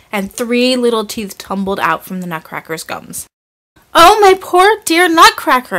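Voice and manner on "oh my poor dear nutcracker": put-on high, loud girlish cry of dismay; distressed exclamation. A narrator's reading. Marie cries out over her broken nutcracker, high and loud. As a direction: wail over the broken toy. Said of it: "exclaimed Marie".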